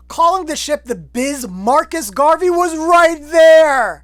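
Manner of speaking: high, loud comic protest; exasperated shout. Pretend. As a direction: holler it in mock disbelief, building to the end. Mock exasperation at the film.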